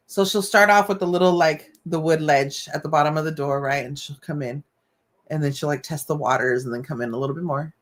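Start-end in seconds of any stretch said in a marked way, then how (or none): none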